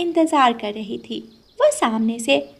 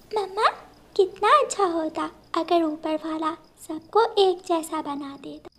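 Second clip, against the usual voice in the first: put-on higher, softer questioning child; wistful little girl; 5 semitones higher, 5 dB quieter than usual. Daughter asking mother why they are poor, higher soft child voice. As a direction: ask mama softly, childlike and wistful.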